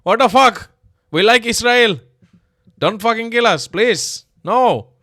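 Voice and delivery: shrill, very loud, high mock panic; mock panicked Indians. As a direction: ham up terrified bystanders shouting. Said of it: "All the Indians are like".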